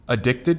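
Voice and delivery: "addicted" as uh-DICT-ed; weak first vowel, stressed DICT in "addicted"; stress on second syllable. Shown as a demonstration. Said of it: "you change the emphasis on the syllable".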